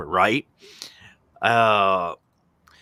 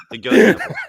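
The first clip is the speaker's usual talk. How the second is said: laughing through the punchline; 10 semitones higher, 11 dB louder than usual. Real, not acted. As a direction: blurt it out laughing, much louder and higher.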